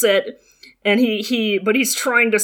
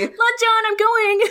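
much higher, cheery farewell; 11 semitones higher than usual. Acted. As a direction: chirp it up high. Voicing character leaving.

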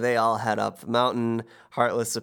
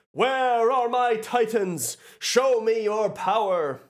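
Hades commanding; 11 semitones higher, slower than usual. Acted. Hades calling his titans from the mountain, slow and booming. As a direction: call out to the titans grandly. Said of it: "Hades turns to the mountain and says".

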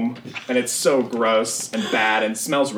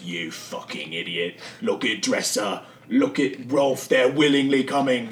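gruff Knocker; slower than usual. Acted. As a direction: sneer it down at a dim henchman. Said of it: "And Knocker goes".

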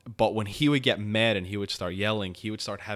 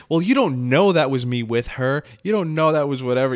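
raised, louder mock denial; furious denial; 4 semitones higher, 8 dB louder than usual. Partner caught cheating, a loud imitated furious denial. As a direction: play the caught cheater denying hotly. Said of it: "the way she's furiously denying it".

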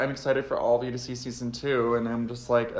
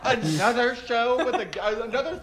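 high, laughing dismissive voice; mock the bored executives; mocking gatekeepers through laughter; laughing mock critic; 8 semitones higher than usual. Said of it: "they're like".

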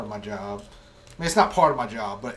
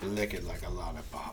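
sexy deep voice; 6 semitones lower, 8 dB quieter than usual. Pretend.

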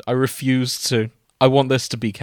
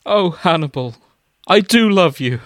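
melodramatic lover; 5 semitones higher, 6 dB louder than usual. Acted. Will's melodramatic love declaration.

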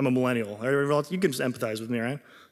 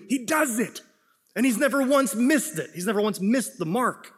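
rising excitement; 8 semitones higher than usual. Real, not acted. Preacher's rising emphasis.

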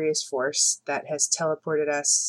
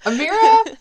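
high loud call for a friend; 11 dB louder than usual. Pretend. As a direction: shout for a lost friend. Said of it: "I shout for everyone".

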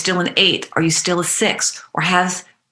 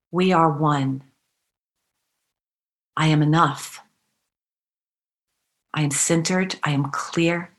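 guided meditation. Acted. Soft guided affirmations.

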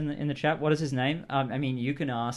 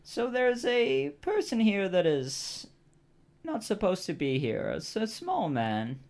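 high, slow, deliberate character voice; quest-giving character; 7 semitones higher, slower than usual. Acted. Quest giver asking about a small man, high slow character voice. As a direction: lay out the quest slowly, pitched up.

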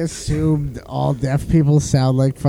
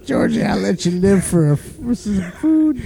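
clown voice; 6 semitones higher than usual. Pretend. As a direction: bargain like a creepy sewer clown. Clown bargaining with Georgie.

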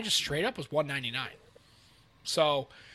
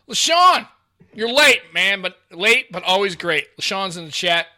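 calling out a greeting; 2 semitones higher, 10 dB louder than usual. Real, not acted. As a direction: hail a latecomer loudly, name punched highest. Greets late viewer joining the chat.

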